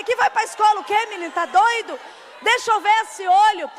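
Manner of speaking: half-shouted scolding at a cheeky son. Retold or imitated. Mother scolds her son who wants to go to school, loud mock-stern.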